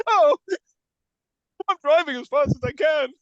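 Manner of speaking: high pleading hostage voice; mock scared hostage. Hostage pleading with the chimp at the wheel, high and panicky.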